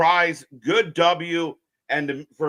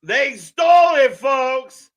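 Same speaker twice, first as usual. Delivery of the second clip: loud, high cry of robbery to the audience; mock outraged shout; 8 semitones higher, 7 dB louder, slower than usual. Real, not acted. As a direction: yell it like a robbed fan.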